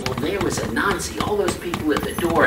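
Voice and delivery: plain reading voice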